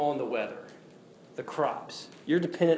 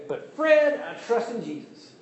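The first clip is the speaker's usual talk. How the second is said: high, whiny imitation of a listener; a hint of a pious objector; imitating a churchgoer; 9 semitones higher, 4 dB louder than usual. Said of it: "most of us would say".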